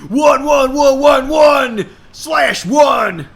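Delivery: high, loud furious shout; angry dad. A narrator's reading. Billy Ray shouting angrily at Hannah. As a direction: yell at her for staying out. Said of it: "so much of angry".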